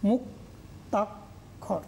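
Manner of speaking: "মুক্তাক্ষর" whispered very slowly and softly; whispered slow syllable demo. Shown as a demonstration. Teacher to class, whispering "মুক্তাক্ষর" slowly as a model. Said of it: "একইভাবে হবে".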